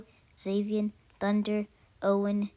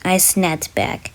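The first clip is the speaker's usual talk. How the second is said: loud, intense storytelling; loud narration; 16 dB louder than usual. A narrator's reading. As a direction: tell the nightmare loudly.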